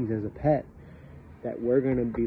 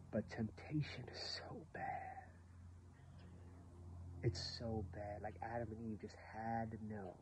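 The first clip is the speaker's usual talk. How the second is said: hushed warning; 17 dB quieter than usual. Real, not acted. Grave aside on temptation.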